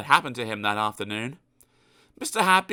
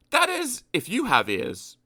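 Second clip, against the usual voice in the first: ordinary delivery, nothing marked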